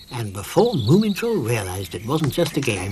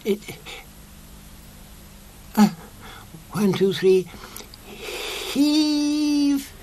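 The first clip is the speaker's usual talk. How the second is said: high, squeaky voice heaving with effort; straining squeaky Sniff; 11 semitones higher, slower than usual. A narrator's reading. Sniff urges the others to heave, high and straining. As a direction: strain to heave the heavy hat.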